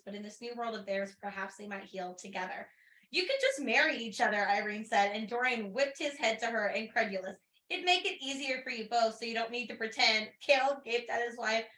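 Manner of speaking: calm audiobook-style read of a novel excerpt; story narration. A narrator's reading. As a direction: narrate the scene like reading to friends. Reading the novel passage aloud.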